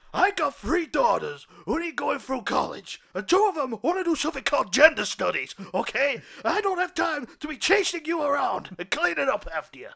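loud, high stressed captain ranting; exasperated captain. Acted. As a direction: rant at the cop who costs you. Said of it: "He goes".

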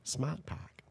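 quiet, lightly done Boston "Smart Park" with dropped r; Boston accent. Retold or imitated. Friend recalling the car ad, soft Boston accent. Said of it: "did that accent really well".

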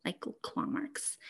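slow, whispered for effect. Acted. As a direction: drop to an eerie whisper. Repeats claw marks, second hushed.

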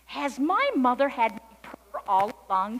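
loud, rising, amazed question; wondering. A narrator's reading. Kitten cannot believe her ears, wondering loudly. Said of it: "she wondered".

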